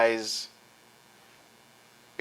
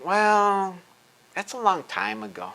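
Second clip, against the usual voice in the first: 1 dismissive; 5 semitones higher than usual. Retold or imitated. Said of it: "mocking opponents' dismissal".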